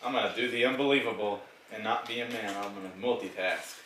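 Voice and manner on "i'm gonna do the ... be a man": ordinary talk, nothing marked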